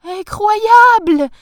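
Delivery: very loud, high, stretched shriek of delight; thrilled, drawn-out shout; ecstatic wife. A narrator's reading. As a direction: exclaim it as if jumping for joy. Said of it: "en sautant sur place".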